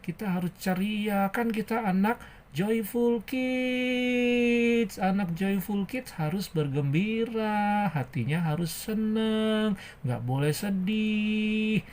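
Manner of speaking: upbeat, drawn-out pep talk; cheerful encouraging character. Acted. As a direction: cheer the children on, stretching key words. Cheerful character urges joy.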